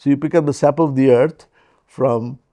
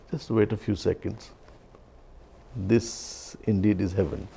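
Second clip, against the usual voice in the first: calm soft monk; 2 semitones lower, 8 dB quieter than usual. Retold or imitated. Monk calmly answers the samurai.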